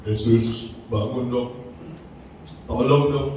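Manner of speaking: mock whining. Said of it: "langsung merengek-rengek ke Yesus".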